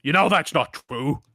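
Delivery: stern rebuke. Acted. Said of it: "the one to his left goes".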